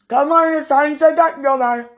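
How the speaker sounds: very high, loud hammed-up call; playful mimicry, pushed high and loud; mimicking sweetie. Woman imitates sweetie coming to get her, high loud mock call. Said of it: "I can't mimic you".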